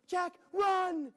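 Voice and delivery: high, loud cry to flee; urgent shout. Acted. Princess warning Jack as the giant comes, shouting. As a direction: yell at him to run.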